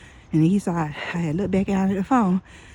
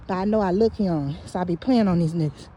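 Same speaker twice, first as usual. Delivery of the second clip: ordinary delivery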